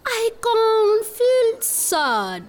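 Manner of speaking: slow, stretched singsong phrases; model recitation. Shown as a demonstration. Teacher modelling lines for children to echo, slow singsong. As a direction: draw out each feeling with high swoops. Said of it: "repeat after me".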